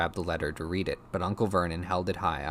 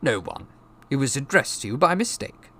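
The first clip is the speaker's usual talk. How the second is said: brusque uncle, slightly raised; short reply; 7 semitones higher, 5 dB louder than usual. A narrator's reading. Uncle brushing off nephew's question, brusque reply. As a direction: dismiss him briefly. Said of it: "said Uncle Vernon shortly".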